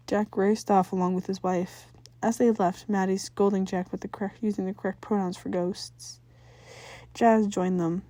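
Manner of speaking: ordinary delivery, nothing marked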